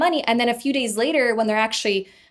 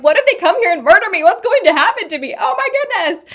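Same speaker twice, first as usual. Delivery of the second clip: higher, louder, faster frantic panic; hammed-up hysterical fear, climbing even higher at the end; panicked; 9 semitones higher, 6 dB louder than usual. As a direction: replay the terror of being threatened. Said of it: "bawling my eyes out".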